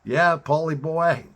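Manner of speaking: loud, high hello to a buddy; excited greeting. Real, not acted. Greeting his friend Paulie, loud and excited. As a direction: call out big and happy.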